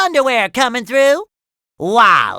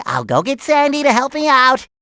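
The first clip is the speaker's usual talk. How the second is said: ordinary talk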